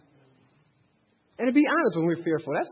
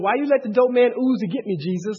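mock complaint; 5 semitones higher, 6 dB louder than usual. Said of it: "mad at the Lord going".

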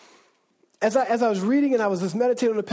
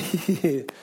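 fake polite laugh; 5 semitones lower than usual. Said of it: "you're like".

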